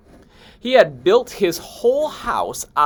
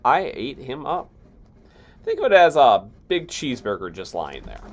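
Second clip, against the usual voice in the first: ordinary delivery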